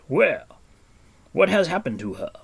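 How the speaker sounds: loud, high, urgent questioning; alarmed demand. A narrator's reading. Gwydion alarmed, face tightened, questions.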